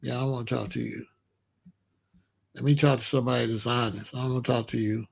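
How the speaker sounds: ordinary delivery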